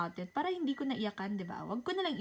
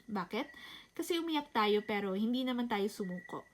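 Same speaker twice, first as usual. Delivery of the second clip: ordinary delivery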